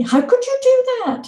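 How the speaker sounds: put-on high, reproachful child's cry; indignant child. Children scolding their mother for her secret, high and reproachful. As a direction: throw the hurt question at mum.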